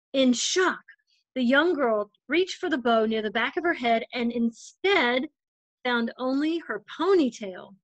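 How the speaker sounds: gentle storybook read-aloud voice; story narration. A narrator's reading. Volunteer reader telling a picture book to children, gentle storytelling.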